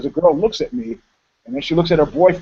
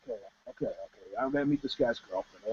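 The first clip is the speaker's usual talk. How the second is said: much softer, lower giving in; resigned mumble; 6 semitones lower, 16 dB quieter, faster than usual. Retold or imitated. Eugene gives in to the Russian guy, mumbling resigned. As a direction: sigh it out under the breath.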